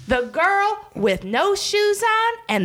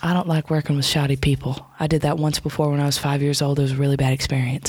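much lower, gruff put-on voice; low comic growl, faster at the end; deep mock grumble; 13 semitones lower than usual. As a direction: grumble it down low like a cranky old-timer. Crystal complains in odd voice.

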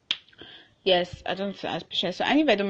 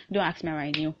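normal talking voice

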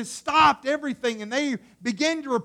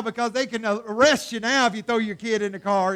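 ordinary talk, nothing marked

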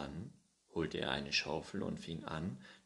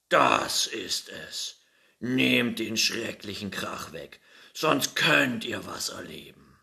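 bellowing bull; 4 semitones higher, 11 dB louder than usual. A narrator's reading. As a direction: roar it out like an enraged bull. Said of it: "Laut brüllte er".

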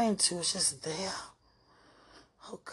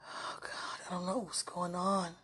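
shaken whisper; barely voiced, at a loss; 7 dB quieter than usual. Real, not acted. Whispering to sweetheart that she is overwhelmed.

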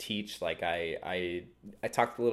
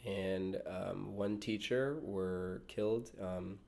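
somber; 5 dB quieter, slower than usual. Real, not acted. Reporting school shooting, slow.

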